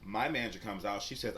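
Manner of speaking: ordinary talk, nothing marked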